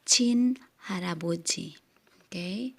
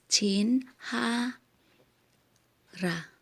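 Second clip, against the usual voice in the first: slow syllables; 3 semitones higher, slower than usual. Shown as a demonstration. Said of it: "yung pag-pronounce lang".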